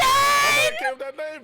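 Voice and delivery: loud, slow, high shout. Real, not acted. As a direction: bellow it out slowly. Shouting his joke claim.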